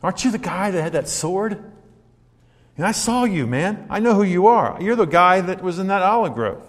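put-on a girl pointing out Peter; accusing servant girl.

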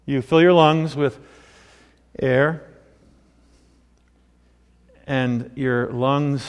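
ordinary delivery

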